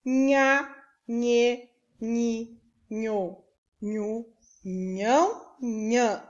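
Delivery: "nhá, nhê, nhi, nho, nhu, nhão, nhã" as isolated syllables; leitura de sílabas. Shown as a demonstration. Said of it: "Eu vou ler". ("nhá, nhê, nhi, nho, nhu, nhão, nhã" recitado pausadamente, um a um)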